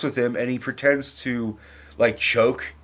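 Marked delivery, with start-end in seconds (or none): none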